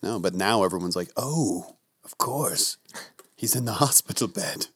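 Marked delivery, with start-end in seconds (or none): 1.2-4.7 dawning realization. Retold or imitated. Imitating everyone's belated realization.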